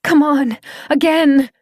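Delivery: high, loud push; rally him up with your voice; urgent shout. Acted. Claire urging Doran to try again, loud high shout.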